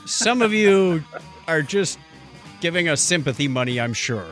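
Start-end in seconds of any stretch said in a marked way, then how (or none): none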